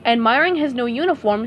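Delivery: usual speaking voice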